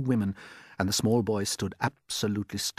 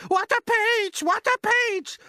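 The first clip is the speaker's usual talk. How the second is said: a far higher, louder aunt's shout; Aunt Spiker shouting; 8 dB louder, slower than usual. Acted. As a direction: screech it out as the nasty aunt. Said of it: "Aunt Spiker shouted".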